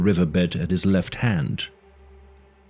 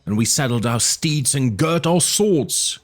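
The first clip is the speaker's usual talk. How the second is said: much higher screeching chieftain voice; screeching old chief; 9 semitones higher than usual. Acted. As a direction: screech the news in rage. Old chief accusing Conan.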